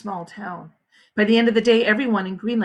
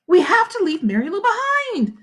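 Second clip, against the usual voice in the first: urgent; 10 semitones higher, 4 dB louder than usual. A narrator's reading. Catherine urgent.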